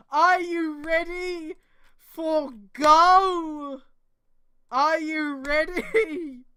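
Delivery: high, loud, slow announcer call; mock hype. Reader hyping the Kamen Rider entrance, mock announcer. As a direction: drag it out like a hype intro.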